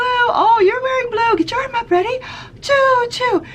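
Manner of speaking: high, slow playful prompts with gasps; excited teacher calls. Shown as a demonstration. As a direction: make colors exciting for toddlers. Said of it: "You can say".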